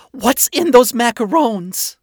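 loud, high, drawn-out demand; alarmed demand. A narrator's reading. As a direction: demand an answer, pitched high. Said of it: "Charlie demanded".

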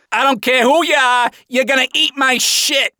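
high yell from the stage. A narrator's reading. Gigi threatens from the stage, yelling. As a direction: yell a crude threat across the crowd. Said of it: "Gigi yelled from the stage".

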